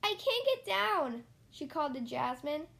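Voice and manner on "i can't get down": high, slow, scared little voice; nervous child. Acted. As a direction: plead it from the top, frightened. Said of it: "she got nervous".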